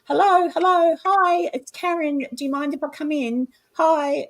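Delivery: high, sing-song polite calling out; friendly visitor greeting. Shown as a demonstration. Investigator asking spirits to let her in, cheery greeting. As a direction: announce yourself sweetly to an unseen household. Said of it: "like I'm walking into someone's home".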